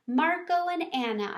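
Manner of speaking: high, excited squeak; hamster squeak. A narrator's reading. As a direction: squeak the names out happily. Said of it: "I squeaked".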